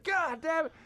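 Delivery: high, strained retold curse; exasperated high-pitched outburst; panicked cursing. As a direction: curse in rising alarm. Retold panic at dog shock.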